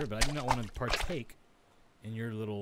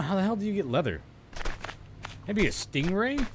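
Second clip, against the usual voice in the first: high, loud exasperated question; exasperated puzzling; 8 semitones higher, 4 dB louder than usual. Real, not acted. Gamer stumped on crafting leather, exasperated. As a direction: wonder aloud in disbelief.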